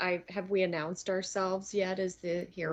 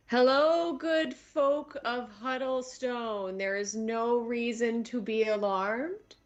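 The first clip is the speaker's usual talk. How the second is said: grand announcement; 3 semitones higher than usual. Acted. Dragonborn addressing the saloon crowd, slow and grand. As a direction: address the room like a herald.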